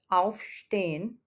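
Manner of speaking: "aufstehen" stretched slowly with a rising end; a slow closing model of "aufstehen"; slow word. Shown as a demonstration. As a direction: drag out "aufstehen" syllable by syllable. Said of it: "teacher says the word twice".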